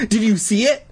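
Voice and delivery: high, rising mild cry; hesitant, breathless cry. A narrator's reading. Todd to the girls after the worm, mild cry. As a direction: ask high with a touch of urgency. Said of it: "Todd cried hesitantly and breathlessly".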